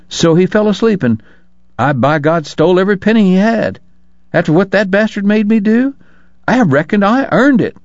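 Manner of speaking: high-pitched, self-righteous woman; indignant prostitute. A narrator's reading. Woman confessing to stealing every penny, high and indignant.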